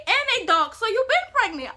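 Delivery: high, loud announcement; mom's loud verdict. Retold or imitated. Mom reading the pregnancy test, loud and high. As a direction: call it out like a verdict. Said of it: "she said".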